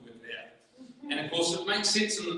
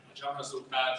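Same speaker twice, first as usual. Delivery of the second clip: normal talking voice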